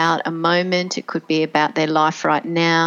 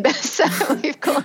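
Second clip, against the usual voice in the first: talking through a laugh, higher pitch; 9 semitones higher than usual. Real, not acted. Speaker amused at the group's discarded name, laughing. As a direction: let real laughter break into the words.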